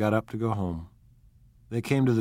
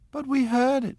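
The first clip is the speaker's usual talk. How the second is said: insisting; 3 dB louder than usual. Acted. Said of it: "insisted Daisy".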